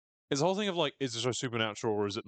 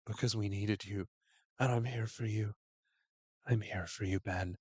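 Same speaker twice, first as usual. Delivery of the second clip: creepy soft Misty; 3 dB quieter than usual. Retold or imitated. Host imitating Misty reassuring Ben, softly creepy. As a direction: murmur it like an unsettling comforter. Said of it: "absolutely fucking terrifying line read".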